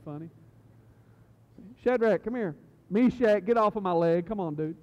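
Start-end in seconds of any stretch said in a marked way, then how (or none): 1.8-4.8 loud, higher mock scolding of the kids; playfully exasperated parent. Dad imagining calling his oddly named kids, mock-exasperated shout. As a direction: call out to unruly children in pretend annoyance.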